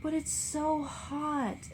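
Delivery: Daisy near tears in the heat; on the verge of tears. A narrator's reading. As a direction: drag it out, close to crying. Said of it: "on the verge of tears".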